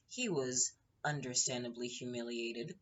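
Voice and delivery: ordinary talk, nothing marked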